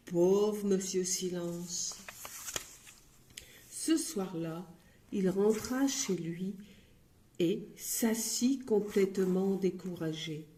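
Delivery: plain reading voice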